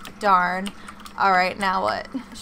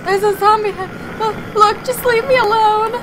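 scared squeal; 11 semitones higher, 7 dB louder than usual. Real, not acted. Scared reaction to zombie head.

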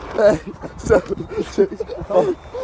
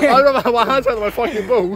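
laughing; 7 semitones higher, 5 dB louder than usual. Real, not acted. Laughing after the stunt.

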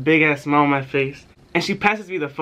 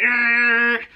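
angry growl; 7 semitones higher than usual. Retold or imitated. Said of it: "She's like".

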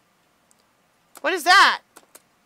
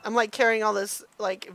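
usual speaking voice

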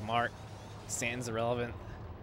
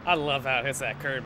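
normal talking voice

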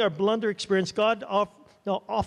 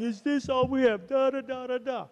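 mock crying; 7 semitones higher than usual. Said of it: "And he's crying".

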